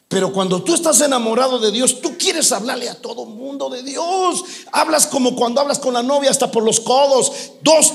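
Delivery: loud, high-pitched sermon at full force; fervent preaching. Acted. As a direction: drive it home to the congregation.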